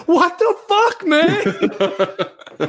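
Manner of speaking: a high cry of surprise from a stunned bystander; shocked outburst. Acted. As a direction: blurt it out like you can't believe what you just saw. Aaron shocked by the attack.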